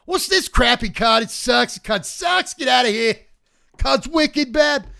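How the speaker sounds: put-on loud, high, slow Northeastern accent, trashing the card; a brash Boston-style Northeastern rant, loud and slow. A card reviewer mocking a bad card, in a loud Northeastern accent. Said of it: "put on my Northeastern accent".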